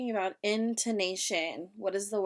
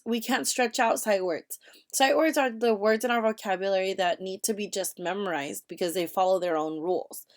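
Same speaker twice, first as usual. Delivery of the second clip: usual speaking voice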